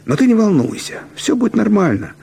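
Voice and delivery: put-on high, eager boy reassuring his friend; boy bluffing to reassure a friend, high and eager; confident boy Bychun. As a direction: pitch it up, a cocky kid promising it'll work. Бычун reassures, character voice.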